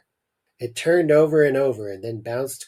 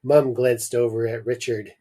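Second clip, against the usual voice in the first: usual speaking voice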